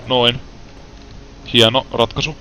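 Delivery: ordinary talk, nothing marked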